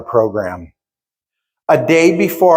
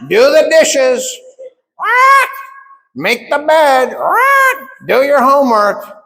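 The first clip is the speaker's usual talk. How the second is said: high, slow, loud sing-song nagging, much higher; parroting nagging dad; 11 semitones higher, 3 dB louder, slower than usual. Retold or imitated. Son mimicking his dad's nightly chores, high and parrot-like. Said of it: "you're just like a parrot".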